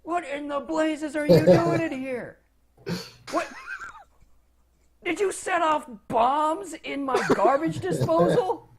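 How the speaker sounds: high-pitched, indignant wizard interrogation; high and outraged; surly wizard. Acted. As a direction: demand answers from intruders who wrecked your place. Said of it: "his face getting surlier and surlier".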